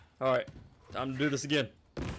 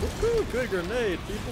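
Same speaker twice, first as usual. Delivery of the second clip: excited cheer; 8 semitones higher than usual. Real, not acted. Celebrating a grenade throw.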